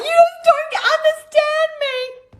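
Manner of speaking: high, loud, slow wounded wail; hammed-up hurt, dragging every word; high slow mock whine at her husband. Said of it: "And I'm like".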